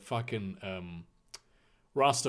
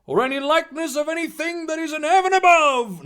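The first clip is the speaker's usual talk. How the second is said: high, loud mock-sermon reading; preacher voice; 10 dB louder than usual. Reader attempting Exodus as a preacher, proclaiming. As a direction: proclaim the commandment from the pulpit. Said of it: "preacher voice".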